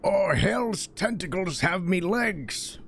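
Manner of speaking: slow, high dwarf cry of alarm; gruff dwarf voice. Acted. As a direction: play a panicked old dwarf.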